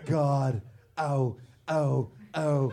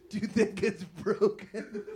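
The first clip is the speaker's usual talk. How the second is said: ordinary talk, nothing marked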